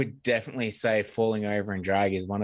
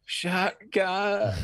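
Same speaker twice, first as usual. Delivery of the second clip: dead person calling shotgun; 8 semitones higher than usual. Retold or imitated. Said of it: "you're there going".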